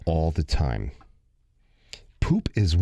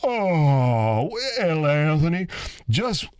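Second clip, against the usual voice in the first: drawn-out groggy groan, pitched up; groggy, stretched-out reply to a child; just-woken uncle; 6 semitones higher, slower than usual. Retold or imitated. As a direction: play an uncle roused from sleep. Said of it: "I woke him up, and he go".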